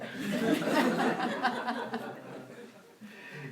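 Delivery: high burst of laughter; real laugh; high amused laugh. Real, not acted. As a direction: laugh at your own quip. Laughs at own joke.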